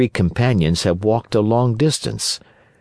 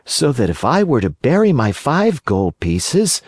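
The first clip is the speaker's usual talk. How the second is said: growing wonder; 6 semitones higher than usual. Acted. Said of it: "with growing wonder".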